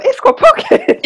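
high laughing squeal; squealing delight. Retold or imitated. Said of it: "And gone".

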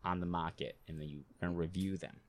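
lightly voiced New York tough guy; Italian-American accent. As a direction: put on a mobster accent. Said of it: "You don't like my accent?".

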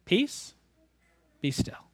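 soft "Peace" rising, "be still" falling quiet; gentle quote. Shown as a demonstration. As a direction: say the three words softly. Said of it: "he says three words".

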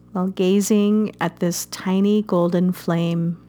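gentle meditation guide, unhurried; guided meditation. Acted. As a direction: ease the listener into calm breathing. Leads a guided fire meditation.

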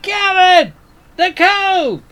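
high, loud lookout call; shouting from crow's nest. Acted. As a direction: call out from up the mast. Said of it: "From the crow's nest".

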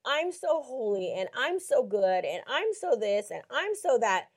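slow, sing-song self-righteous mimicry; mock someone pleased with their own holiness; drawn-out pious boasting. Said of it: "your unrighteousness".

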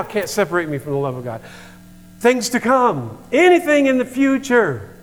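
fired-up sermon delivery; preaching at full force. Real, not acted. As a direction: preach it hard and high.

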